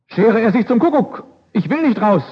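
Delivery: mild mock anger at being woken; angry king. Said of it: "Wütend fuhr der Schläfer hoch". (Der geweckte König fährt den Diener wütend an)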